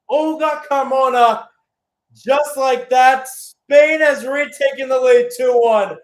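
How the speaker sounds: high, loud roar of disbelief at the goal; shouted goal call. Real, not acted. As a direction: yell it out as the ball hits the net. Goal call in live commentary.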